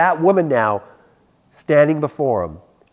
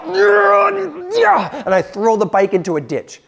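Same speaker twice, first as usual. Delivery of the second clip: dramatic retelling; 12 semitones higher, 5 dB louder, slower than usual. Retold or imitated. Kid throws bike in anger.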